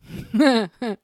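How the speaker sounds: short amused laugh. Real, not acted. Amused at what mom used to say, short chuckle. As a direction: let out a real little laugh. Amused by mom's old saying.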